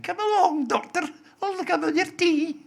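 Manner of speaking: high, loud mock-Irish housekeeper voice; Barbara Mullen, Irish. Mimicking Irish actress calling the doctor, shrill Irish impression. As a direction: sing out a hammed-up Irish call, pitched very high. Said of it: "There was Barbara Mullen, who's Irish".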